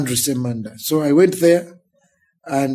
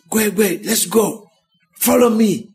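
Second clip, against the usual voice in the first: urgent conductor; 7 semitones higher than usual. Retold or imitated. Conductor urging boy to follow.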